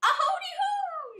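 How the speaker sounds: high, loud shriek; yelling. A narrator's reading. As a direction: yell it out high. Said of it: "she yelled again".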